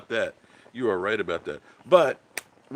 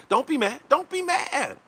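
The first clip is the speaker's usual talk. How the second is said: high sing-song teasing, second one higher; teasing don't be mad; 11 semitones higher than usual. Pretend. Teasing angry viewers over the prank, sing-song and high. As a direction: coax them like a kid, playfully.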